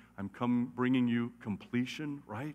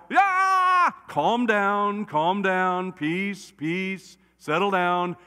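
hammed-up calming; 5 semitones higher, 5 dB louder, much slower than usual. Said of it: "And now".

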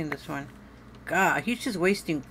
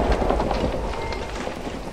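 startled breathless gasp; sharp gasp. Real, not acted. Player jumps at a noise, sharp gasp. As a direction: suck in air in fright.

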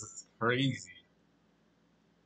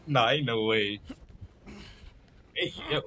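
usual speaking voice

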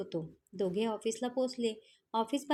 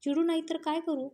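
higher, louder angry snap back; angry retort; 6 semitones higher, 4 dB louder than usual. A narrator's reading. Raj snaps back at Alka in irritation, an angry higher retort. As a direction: fire it back, exasperated and cross. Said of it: "राज म्हणतो चिडून".

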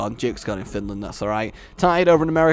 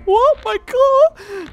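very high, squeaky shocked voice; squeaky wounded victim; 6 dB louder, much slower than usual. Acted. Mocked enemy answers the villain's taunt, squeaky hurt. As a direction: squeal in mock hurt and disbelief.

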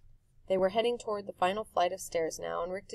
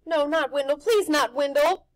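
put-on higher, louder boy begging; Harvey pleads for his friend; pleading; 8 semitones higher, 13 dB louder than usual. A narrator's reading. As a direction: beg it not to be true.

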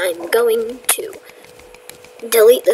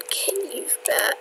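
whispering; 4 semitones higher, 7 dB quieter than usual. Real, not acted. Scared of bats in cave.

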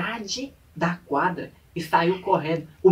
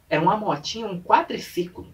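ordinary talk, nothing marked

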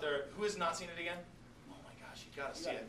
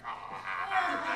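satisfied ah, mock; 8 semitones lower, 7 dB louder than usual. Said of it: "he's just like".

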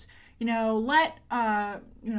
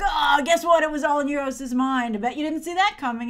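louder, higher mock gloating; smug taunting; 4 semitones higher, 6 dB louder than usual. Mocking the show's writers, in loud smug gloating.

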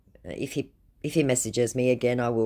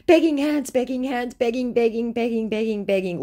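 mock begging; 9 semitones higher, 4 dB louder than usual. Reading out a scammer's many begging emojis, mock begging. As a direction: chant the emojis as a hammed-up plea.

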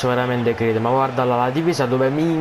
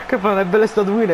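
laughing; 6 semitones higher than usual. Real, not acted. Ride dopo il gol.

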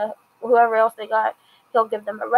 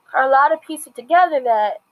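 ordinary delivery

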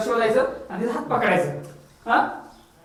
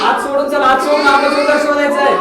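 raised, louder and higher voice; game leader calling above the din; calling out loudly; 8 semitones higher, 5 dB louder than usual. Real, not acted. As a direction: shout instructions over a noisy group. Directing children over noisy animal calls.

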